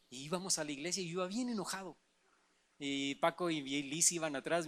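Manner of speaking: normal talking voice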